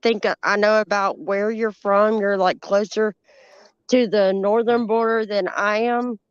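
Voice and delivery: ordinary talk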